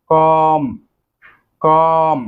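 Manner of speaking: কম said slowly and clearly, with the final b silent; careful pronunciation. Shown as a demonstration. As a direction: model the word কম for the class. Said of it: "উচ্চারণ হবে".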